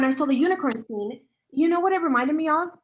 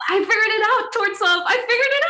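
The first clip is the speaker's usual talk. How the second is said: high, loud burst of excitement; excited discovery; 9 semitones higher, 8 dB louder than usual. Acted. Author bursting with news to her director, high and loud. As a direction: blurt it out, thrilled.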